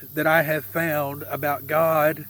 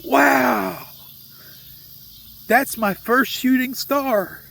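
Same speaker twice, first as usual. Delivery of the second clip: imitating an awestruck son, pitched up; big drawn-out wow, higher all through; freaked-out amazement; 6 semitones higher, 3 dB louder, slower than usual. Dad imitates his son seeing a shooting star, freaked-out amazement. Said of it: "my son freaked out".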